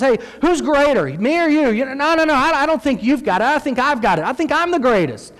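high-pitched bickering back and forth; mock squabbling. Disciples arguing who is the greatest, mock squabble. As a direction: imitate rivals arguing, pitch pushed up.

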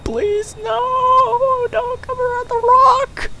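very high, crying shriek of mock terror; shrieking plea. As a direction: squeal it out in hammed-up terror. Begging the enemy while hiding.

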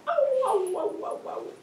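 howls fading away down the well; fading wolf cry. A narrator's reading. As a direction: let the howl sink and vanish. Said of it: "Il tombe dans le puits et crie". (hurlement qui s'éteint au fond du puits)

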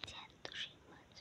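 late-night hushed whisper. Real, not acted. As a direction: whisper so no one wakes. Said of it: "কেন এত আস্তে আস্তে কথা বলছি".

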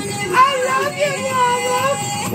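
very high, slow, cracking cry of love; ecstatic cry. Real, not acted. Fan crying out love high to his idol. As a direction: wail it up high like a swooning fan.

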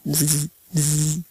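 two soft voiceless buzzes; bee buzzing. A narrator's reading. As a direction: buzz like a bee, zzz. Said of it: "faceva l'ape Betty".

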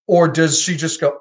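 ordinary delivery

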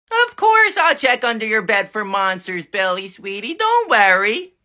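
low, soothing grandma voice; calm him like a doting grandma; reassuring grandma. Acted. Grandma comforts scared Billy, soothing.

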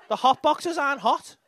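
ordinary talk

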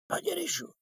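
very high, thin, despairing cry; desperate wail. A narrator's reading. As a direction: let the voice crack up into panic. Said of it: "zawołał rozpaczliwie".

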